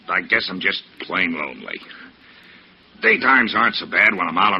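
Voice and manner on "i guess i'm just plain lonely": lonely. Acted. Salesman confessing loneliness.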